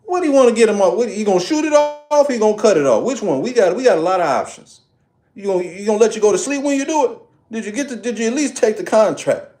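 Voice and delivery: high, fast, incredulous mother firing questions; mama grilling her son on how the toe comes off; mama voice again. Retold or imitated. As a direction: rattle off questions like a stunned mom. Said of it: "my mama might tell me".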